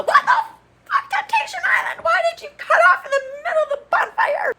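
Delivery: very high, loud screechy shout; laughing fake fury at the show; mock Vanessa rage. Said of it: "I'm gonna turn into Vanessa".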